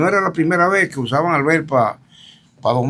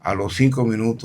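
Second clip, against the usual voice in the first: ordinary talk, nothing marked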